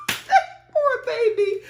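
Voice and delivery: high, very slow sympathetic coo; slow, high poor-thing sigh; drawn-out pity. Real, not acted. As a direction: stretch it out, cooing in pity. Pity for the child.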